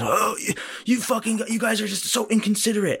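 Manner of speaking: high-pitched mock indignation; irritated stranger. Annoyed customer scolding the people ahead at the coffee shop, mock indignant. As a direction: imitate a flustered, complaining man. Retold stranger's angry complaint.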